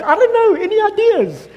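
very high, baffled mock voice; clueless Holy Spirit. Pastor voicing a puzzled Holy Spirit, high mock cluelessness. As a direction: shrug it off helplessly. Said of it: "the Holy Spirit is like".

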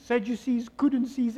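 usual speaking voice

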